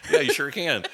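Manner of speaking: laughing along, real amusement; laughing agreement. Real, not acted. Answering his cohost's surf and serve joke, laughing. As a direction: chuckle through the words, then agree.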